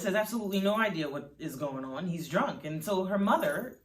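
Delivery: ordinary delivery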